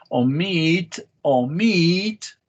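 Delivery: "Omit" with MIT long and strong, twice; stress on second syllable. Shown as a demonstration. Teacher shows the second syllable, "Omit" stressed on MIT. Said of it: "You have the second syllable here".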